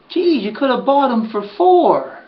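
Earl's jab. Retold or imitated. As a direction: drag out the dig to sting. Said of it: "He goes".

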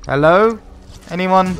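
loud, high questioning call; shouted down a well. Pretend. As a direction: call out to see if anyone is there. Calling into a well.

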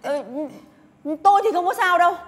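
loud, high distressed protest; indignant outburst. Acted. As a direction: defend her child fiercely. Bức xúc vì con bị ảnh hưởng.

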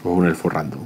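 usual speaking voice

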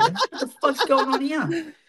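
high, slow, laughing mock shock; laughing disbelief. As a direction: stretch it out in amused disbelief. Discovering the shrunken spider.

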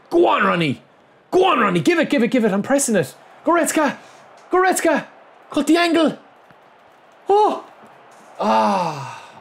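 high-pitched screamed commands; frantic urging. Real, not acted. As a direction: scream your players on, then cry out at the miss. Urging players on, misses chance.

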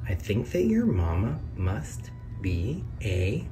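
ordinary delivery

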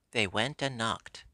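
ordinary delivery, nothing marked

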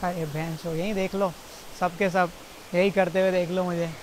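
ordinary talk

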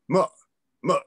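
"ma" dipping down and then up; gut-punch tone. Shown as a demonstration. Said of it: "you got punched in the gut tone".

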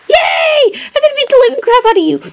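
high, fast, gleeful yelling; excited shout. Acted. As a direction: rattle it off in a gleeful rush. Promised toys, gleeful threat.

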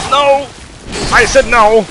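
loud, high angry yell; shouting. Real, not acted. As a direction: yell it at the enemy. Yelling at game enemy.

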